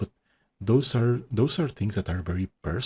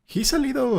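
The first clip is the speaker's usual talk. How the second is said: laughing; 4 dB louder than usual. Real, not acted. Laughing while describing creator.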